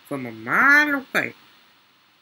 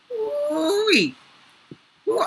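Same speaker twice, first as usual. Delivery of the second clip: gleeful ooh-wee; much slower than usual. Real, not acted. About to get a big kill.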